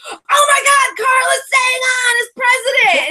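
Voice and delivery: high, loud shriek of breaking news; re-enacted fangirl screaming at the TV; retold excited scream. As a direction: scream the gossip across the room, hammed up. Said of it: "And meanwhile, I'm screaming".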